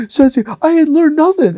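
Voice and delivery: high put-on voice of a complaining pupil; hammed-up grievance to the teacher; whiny student impression. Student protesting to sensei before a slap, mock complaint.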